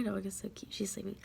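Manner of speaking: hushed whisper so the sleeping dog stays asleep; soft unvoiced whisper beside the sleeping dog. Real, not acted.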